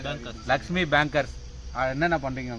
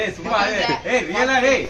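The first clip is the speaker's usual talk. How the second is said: laughing, higher and louder; laughing protest; 6 semitones higher, 3 dB louder than usual. Real, not acted. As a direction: protest through real laughter. Friends teasing him on camera. (சிரித்துக்கொண்டே மறுப்பு)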